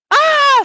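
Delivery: short, loud high scream; sudden high-pitched mock shriek. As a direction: scream it out briefly as a joke. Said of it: "Screaming really, really loud".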